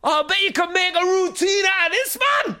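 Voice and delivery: very high, loud Geordie holler; screaming Geordie bloke. Retold or imitated. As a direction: scream it as a heckling Geordie impression. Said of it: "he screamed again".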